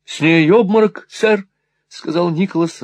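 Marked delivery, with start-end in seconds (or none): 0.0-1.5 higher, louder cry of alarm; alarmed exclamation. A narrator's reading. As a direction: call out as you rush forward. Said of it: "рванувшись вперёд".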